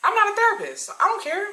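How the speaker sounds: very high-pitched brush-off; dismissive protest. Retold or imitated. Worker refusing to be customer's therapist, dismissive. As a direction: refuse her in a squeaky-high voice.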